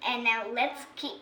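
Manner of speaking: usual speaking voice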